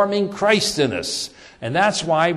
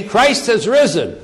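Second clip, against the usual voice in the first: a bright, proclaimed Easter greeting, higher and louder; joyful greeting; 6 semitones higher, 5 dB louder than usual. Pretend. Greeting a fellow believer on Easter morning, proclaimed joyfully. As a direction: announce it like good news to a friend.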